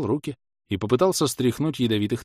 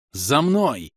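raised, higher rallying call; brisk command; 6 semitones higher, 4 dB louder than usual. A narrator's reading. Inquisitor ordering his servant to follow, called out. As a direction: toss out a quick order. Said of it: "бросил я холопу".

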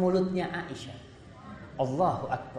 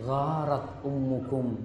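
calm Prophet; 6 semitones lower, 7 dB quieter than usual. Retold or imitated. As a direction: settle the guests gently. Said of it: "Nabi cuma mengatakan".

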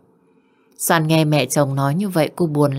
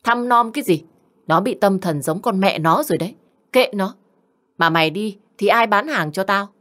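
sneering, higher-pitched retort; mother scolding her son, higher and scornful; 3 semitones higher than usual. Acted. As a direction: brush the son off with contempt. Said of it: "nguýt dài một cái".

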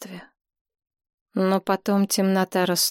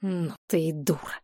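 low-key, softer angry hiss; 3 semitones lower, 6 dB quieter than usual. A narrator's reading. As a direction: hiss it under your breath at the girlfriend. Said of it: "прошипел парень". (злое тихое шипение)

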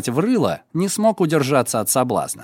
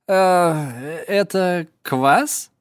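put-on drunk voice; 3 semitones higher, much slower than usual. Yakhman feigns drunkenness.

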